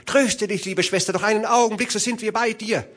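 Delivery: high-pitched call of comfort; reassuring young brother. Acted. Brother calls to his sister that help nears, reassuring call. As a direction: call out hurried reassurance from afar.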